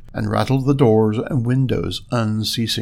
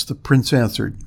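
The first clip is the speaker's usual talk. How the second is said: ordinary delivery, nothing marked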